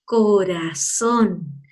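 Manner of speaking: "Corazón" very slow and low, single-tap R; soft R. Shown as a demonstration. Teacher showing pupils the soft R, slow gentle tap. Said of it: "Entonces suena despacito".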